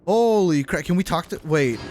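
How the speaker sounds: ordinary talk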